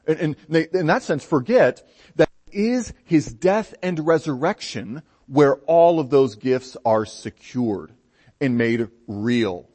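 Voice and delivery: ordinary talk, nothing marked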